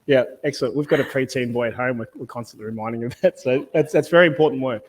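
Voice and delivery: ordinary talk, nothing marked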